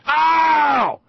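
sudden high, loud "ow"; mock howl of pain; pained yelp. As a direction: yelp as if a scab were ripped off. Imitates scab being torn off.

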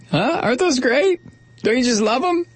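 high, sing-song sarcastic cheer; mock cheerful. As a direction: fake delight over the spill.

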